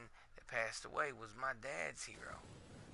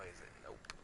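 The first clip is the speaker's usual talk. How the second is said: usual speaking voice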